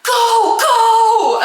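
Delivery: high, loud urgent command; mock frantic shout. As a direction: yell at a driver to floor it. Yelling at Uber driver.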